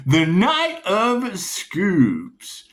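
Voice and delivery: high, loud, very slow; intoned card name. Acted. Announcing the drawn tarot card, slow and grand.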